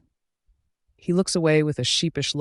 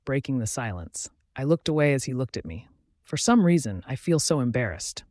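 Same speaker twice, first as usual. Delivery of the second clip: ordinary delivery, nothing marked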